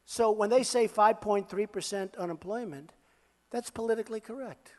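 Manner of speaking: ordinary delivery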